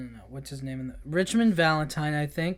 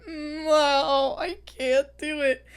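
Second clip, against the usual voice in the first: pleading refusal; 9 semitones higher, much slower than usual. Retold or imitated. Eggsy refuses, voiced near tears.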